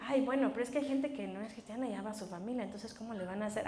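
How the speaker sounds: skeptic's objection. Retold or imitated. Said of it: "algunos dicen".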